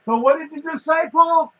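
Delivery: loud, high, distant holler; calling out from across the room. Real, not acted. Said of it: "Now you're hollering".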